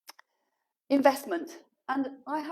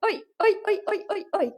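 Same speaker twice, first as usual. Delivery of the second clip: loud, high repeated oi fading down; sharp telling-off that winds down; scolding shouts; 3 semitones higher, 3 dB louder than usual. Real, not acted. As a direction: bark oi at the dog, easing off. Scolding the dog.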